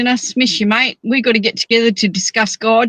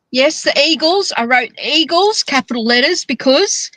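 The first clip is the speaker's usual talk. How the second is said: normal talking voice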